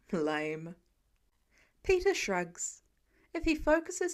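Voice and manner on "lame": lower, dismissive Tony; Tony voiced. A narrator's reading. Tony dismissing Peter's answer, low and unimpressed.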